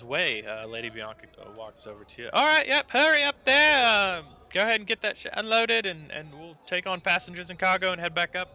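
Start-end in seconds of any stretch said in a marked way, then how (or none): 2.3-3.8 high, very loud call to the crew; captain's full-voiced hurry-up; shouting orders. Lady Bianca calls orders to crew. 4.2-8.5 loud, high, brisk captain; raised-voice bossy command; calling out orders. Acted. Lady Bianca ordering the crew.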